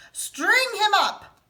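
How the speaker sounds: high, loud, slow furious demand; angry mob shout. Acted. The men of the town against Big Anthony, slow furious shout. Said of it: "the men of the town shouted".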